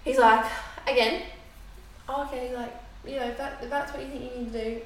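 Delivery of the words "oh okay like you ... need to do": quiet, easygoing, unbothered; lightly voiced relaxed boyfriend; calm and unbothered. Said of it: "again, calm".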